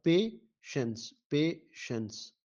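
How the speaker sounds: पेशेंस slowly split "pay / shens"; syllable division. Shown as a demonstration. Teacher answering a syllable question, पेशेंस parted as pay, shens. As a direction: say पेशेंस in two beats with a gap. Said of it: "syllable division of the patience".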